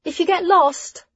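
high calling voice, slowing at end; calling after them. Acted. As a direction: call out after friends leaving. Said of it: "Hessa called after them".

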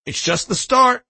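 high, loud proud boast. A narrator's reading. Father boasting to a reporter after his son's win, boastful. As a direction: brag with full confidence. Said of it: "Earl boasted".

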